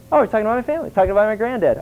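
high, smug delight, hammed-up; mock flattered. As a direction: play the vain son pleased at the praise. King's son mocked as flattered.

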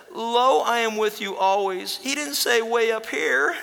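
put-on high, loud child voice, slowing at the end; a frightened girl pleading her point; scared little girl. Girl argues with her dad during takeoff, high and loud.

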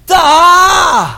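loud, high scornful shout; a big sarcastic yell; mock shout. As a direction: bellow it at the foolishness. Mocking a foolish plan.

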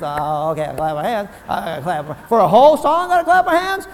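mock a reluctant clapper; a touch of hammed-up reluctance; grudging complaint. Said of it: "You people are like".

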